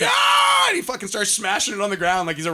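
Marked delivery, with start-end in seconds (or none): 0.0-0.7 high, loud wild yell; rock star roar. Retold or imitated. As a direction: roar like smashing a guitar onstage. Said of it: "he's like".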